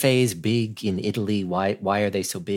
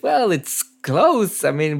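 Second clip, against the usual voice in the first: drawn-out, high-pitched approximation; companies' casual shrug; 6 semitones higher, slower than usual. Retold or imitated. Interviewer voicing European companies' feeling, casual high drawl. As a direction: imitate a vague 'near enough' reply, stretched high. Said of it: "their feeling is".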